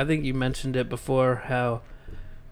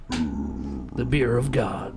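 very slow low announcer; 6 semitones lower, much slower than usual. Pretend. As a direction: savour it like an ad tag. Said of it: "read it like a commercial".

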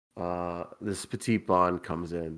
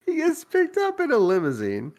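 restarted through real laughter, pitched up high; laughing. Real, not acted. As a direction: crack up as you say it.

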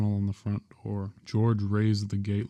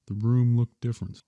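plain reading voice

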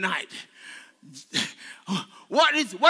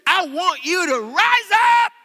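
high, loud preacher's call; shouted rousing command; 7 semitones higher, 7 dB louder than usual. Acted. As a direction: call them up to their feet, loudly. Acting out Jesus's command.

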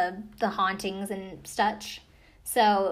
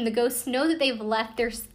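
usual speaking voice

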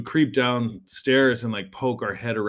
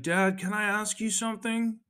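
put-on a much higher little kid asking sweetly; a child voice asking permission; 10 semitones higher than usual. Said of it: "So creep down and be like".

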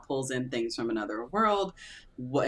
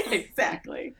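laughing; 6 semitones higher, slower than usual. Real, not acted. Laughing agreement.

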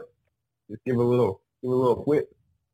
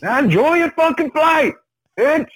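Samuel L. Jackson impression; 11 semitones higher than usual. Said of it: "Samuel Jackson".

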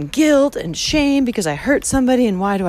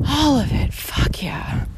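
whispered cheer; 3 semitones higher, 3 dB quieter, slower than usual. Real, not acted. Whispered exclamation.

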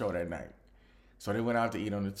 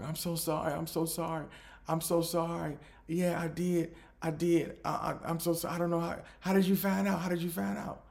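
mock apology; 7 semitones higher than usual. Ex confessing on the phone.

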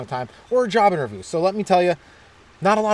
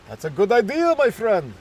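higher-pitched comic character voice; mock scientist's pleased agreement; agreeing scientist; 4 semitones higher than usual. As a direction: approve the scheme in a raised voice. Another scientist agrees in skit.

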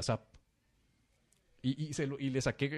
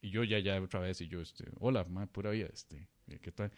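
sheepish mumble; 7 semitones lower, 7 dB quieter than usual. Retold or imitated. Himself meek, low and quiet.

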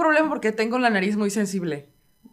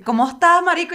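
Venezuelan greeting with dropped S; Venezuelan accent; 5 semitones higher than usual. Retold or imitated. Imitating Poli greeting Santiago, Venezuelan accent with no S. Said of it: "Ni una puta S".

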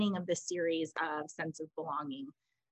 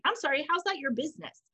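indignant retort; 6 semitones higher, 3 dB louder than usual. Retold or imitated. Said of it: "And I was like".

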